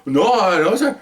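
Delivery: high, startled no, sir; a retold sergeant recoiling in shock; shocked protest. Sergeant startled at the officer's question, high shocked denial. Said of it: "He was so shocked".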